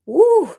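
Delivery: a high, loud whoo to steady herself; emotional woo. Real, not acted. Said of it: "I'm just so emotional right now".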